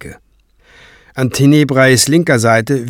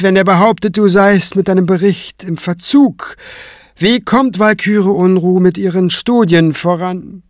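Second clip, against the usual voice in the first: higher, measured voice of authority; imperious high priest; 8 semitones higher, 3 dB louder than usual. Acted. Tenebrae questioning Kranz about his report, imperious. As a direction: preside over the clerics, stern and impatient.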